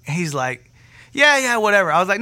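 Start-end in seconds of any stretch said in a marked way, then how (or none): 1.1-1.9 higher, louder mock dismissal; dismissive brush-off. Delivery guy brushing off talk of healing, dismissive. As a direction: wave it away impatiently.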